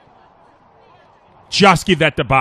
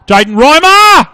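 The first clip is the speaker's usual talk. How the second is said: much higher, louder drawn-out name call; excited shout; 13 semitones higher, 12 dB louder, slower than usual. Real, not acted. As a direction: roar the name as the kick flies. Commentator calls a scoring chance.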